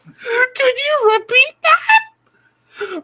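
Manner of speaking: very high, slow falsetto; falsetto customer. Retold or imitated. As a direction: stretch it out in a squeaky falsetto. Customer voice, puzzled.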